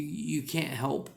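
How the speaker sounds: normal talking voice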